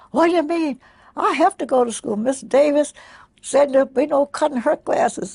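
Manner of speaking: urgent, high-pitched retold outburst; alarmed protest. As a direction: imitate a worried boy blurting it out. Retold boy leaping up in alarm.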